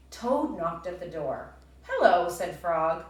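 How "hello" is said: much louder, bright greeting; Frog's cheerful hello. Acted. Teacher voicing Frog greeting Toad at the door, loud.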